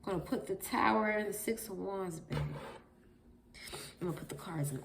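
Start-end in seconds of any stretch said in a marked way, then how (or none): none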